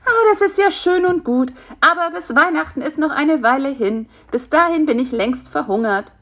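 high fly voice, plaintive protest; objecting hungry fly. Acted. Captive fly objects to waiting until Christmas, plaintive.

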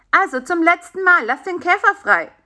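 high-pitched, firm order; bossy little character laying down the law; commanding. A narrator's reading. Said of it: "befahl Bisi".

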